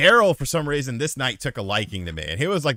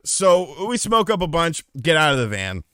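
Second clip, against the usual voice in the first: ordinary talk, nothing marked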